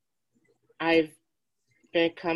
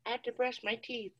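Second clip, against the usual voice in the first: quick soft reply; 6 semitones higher, 8 dB quieter, faster than usual. Retold or imitated. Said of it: "I was like".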